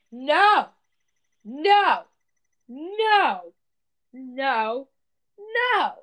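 loud, high, separate refusals; emphatic refusal. Real, not acted. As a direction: punch out each no with a pause between. Emphatic rejection of retold idea.

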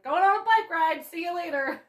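sing-song fake cheer to sneak out; breezy cover story. Drunk guest leaving a party, chirpy innocent voice. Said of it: "and be like".

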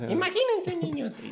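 put-on high, sing-song children's presenter; hammed-up kids' TV voice; mock kids' host. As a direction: talk down sweetly to kids. Mock kids-show host voice.

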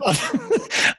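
a real, very high squeaky laugh. Real, not acted. Dad retelling his daughter's remark, real high laughter. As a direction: let a laugh break out high.

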